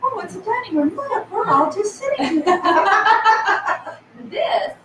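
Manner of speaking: high, giggly, laughing through words. Real, not acted. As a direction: laugh while you talk. Laughing at a silly photo.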